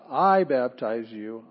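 I loud and slow at the start; emphatic I. Shown as a demonstration. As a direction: punch the word I. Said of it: "it emphasized".

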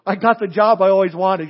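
loud, bursting with happy news; a friend bursting in with good news, loud and excited. Retold or imitated. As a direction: blurt it out, thrilled. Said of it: "And they say".

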